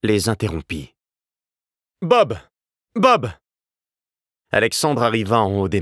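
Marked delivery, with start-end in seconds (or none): 2.0-3.3 high, loud call across the garden. A narrator's reading. Younger brother running up the path, calling his brother by name, loud. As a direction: shout his brother's name as you run up. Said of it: "La voix d'Alexandre, qui remontait l'allée en courant".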